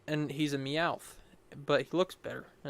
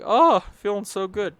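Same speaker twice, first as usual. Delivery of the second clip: higher, louder mock relief; voicing healed teammate; 6 semitones higher, 8 dB louder than usual. Player voices his healed Whisker Death, mock relief. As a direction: give the healed creature a big relieved "oh". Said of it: "he's like".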